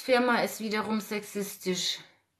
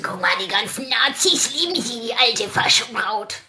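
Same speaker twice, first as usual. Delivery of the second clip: slightly louder sneering imitation, slowing at the end; mocking the critics; 5 dB louder than usual. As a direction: mimic the critics' scorn. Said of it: "Sagen bestimmt wieder die Besen".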